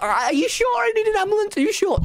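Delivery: high, fast, pleading panic; mock-panicked plea to the doctor. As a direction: beg nervously, voice jumping up. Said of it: "And I'm like".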